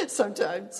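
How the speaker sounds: real laugh breaking through. Real, not acted. Laughing to the congregation about how God talks. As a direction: laughs at her own aside. Laughing at her own joke.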